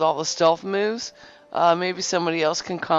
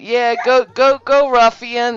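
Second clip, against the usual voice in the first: higher, excited cheering to a dog; real excited call-out to a pet; egging on; 5 semitones higher, 4 dB louder, slower than usual. Real, not acted. Calls out to egg on dog.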